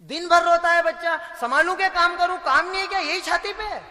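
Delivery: high, loud fed-up mother; loud high mock-exasperated mum. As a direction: complain like a harried mother. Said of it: "कहीं बच्चे को ही संभालूं क्या".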